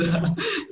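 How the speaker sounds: short, high burst of laughter; quick amused laugh, pitched high. Real, not acted. Laughing after joking praise.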